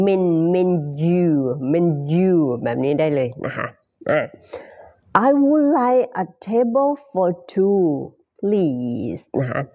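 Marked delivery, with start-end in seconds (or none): 0.1-2.3 menu broken as "men-" then "menu" with a y glide; split syllables. Shown as a demonstration. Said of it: "ตามสัญลักษณ์ ไอพีเอ เลย". 5.3-9.2 I would like a table for two please, word by word; very slow demo. Shown as a demonstration. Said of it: "เป็นภาษาสุภาพนะคะ".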